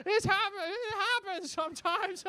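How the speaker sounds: put-on very high, doddery old-woman voice; old lady. Old lady getting back up, high apologetic voice. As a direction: quaver upward as a frail granny. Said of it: "is an old lady".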